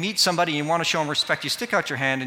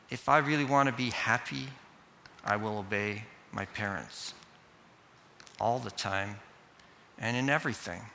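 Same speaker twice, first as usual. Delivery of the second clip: soft, low, with long pauses; child's reflective resolve; 4 semitones lower, 8 dB quieter than usual. Acted. Kid privately resolving to obey parents, soft and deliberate. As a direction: think it through quietly, phrase by phrase. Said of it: "think in this way or something like this".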